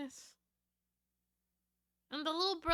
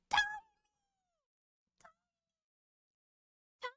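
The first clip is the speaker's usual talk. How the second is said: high squeal trailing into squeaks; gushing squeal; 14 semitones higher, 5 dB louder than usual. Real, not acted. Streamer gushes over a tiny kitten, squealing.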